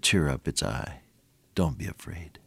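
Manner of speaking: low, soft reassurance; calm the frightened ones softly; gentle, hushed comfort; soothing. Acted. Jesus calming frightened disciples.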